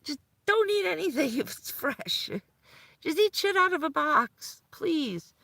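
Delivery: very high, pleading, frantic; panicked doctor. Retold or imitated. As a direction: beg the patient like a flustered doctor.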